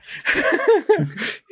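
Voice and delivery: high burst of laughter. Real, not acted. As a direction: squeal with laughter. Laughing at friend's story.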